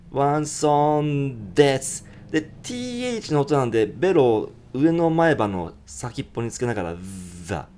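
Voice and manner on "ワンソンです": "ワンソン" said slowly, no G; one son. Shown as a demonstration. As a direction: drop the G at the end. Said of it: "Gがもう消えてません".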